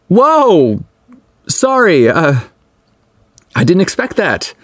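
high-pitched, flustered surprise; startled exclamation. A narrator's reading. Man surprised by a clumsy kiss, startled and high. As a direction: exclaim it high, then falter into an apology. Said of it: "Holt exclaimed".

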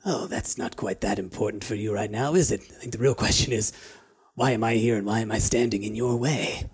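lightly voiced sneaky lizard folk; sly thief voice.

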